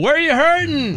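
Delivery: high mock shout; mock yell. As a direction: call it up like a worried yell. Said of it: "just yell".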